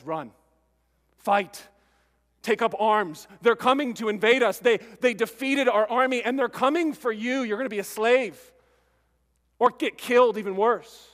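loud urgent alarm, calling people to flee; alarmed warning. Acted. Messenger warning the village of invasion, loud alarm. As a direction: sound the alarm, enemy is coming.

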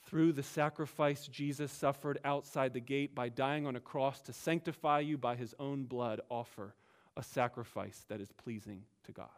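"Through" stressed up front, the rest read softly; a soft, reverent reading led by "Through"; emphatic paraphrase. Shown as a demonstration. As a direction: open on a weighty "Through", then read quietly. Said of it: "So read it like this then".

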